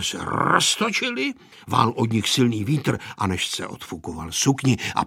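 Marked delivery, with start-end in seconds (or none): none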